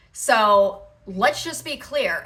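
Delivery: ordinary talk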